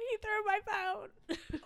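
retelling her tears to her husband, mock sobbing; mock bawling. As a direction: wail it like a sobbing victim. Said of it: "I'm all bawling".

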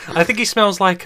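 usual speaking voice

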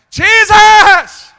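high, loud, drawn-out shout; defiant blind man's yell. Acted. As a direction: holler over the people hushing you. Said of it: "the louder he got".

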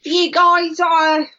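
slow, drawn-out mock Australian accent. Host greeting the girls in Sydney, mock Australian accent. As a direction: put on a broad Australian greeting. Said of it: "she uses a lot of Aussie slang".

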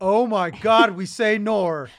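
imitated woman loudly aghast at herself; mock shocked realization. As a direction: exclaim it as a hammed-up revelation. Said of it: "And she was like".

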